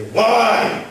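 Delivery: high, loud, fist-shaking why; accusing cry at God; defiant why. Shown as a demonstration. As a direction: demand it of heaven, fists closed. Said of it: "close your fist and look to heaven".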